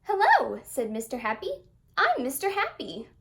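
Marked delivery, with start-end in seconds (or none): 0.0-0.6 cheerful hello. Acted. Mister Happy greets cheerfully. 2.0-3.1 cheerful greeting. Acted. Mister Happy introduces himself.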